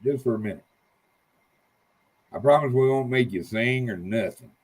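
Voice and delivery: ordinary talk, nothing marked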